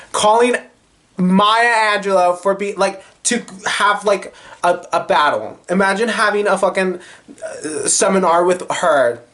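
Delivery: can you believe this person; incredulous outrage. Real, not acted. Telling viewers about the producer, high incredulous excitement.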